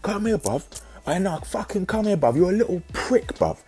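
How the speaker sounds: high, mock-aggressive "come here, bruv"; hammed-up impression of a lad picking a fight; imitating angry Lewis. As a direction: ham up a lad squaring up for a fight. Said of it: "he goes up to me and goes".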